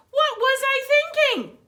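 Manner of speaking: high, loud, disbelieving Santa; mock-despairing Santa cry; exasperated Santa. Santa scolds himself over the Easter briefs, high and loud.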